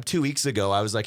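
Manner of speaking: usual speaking voice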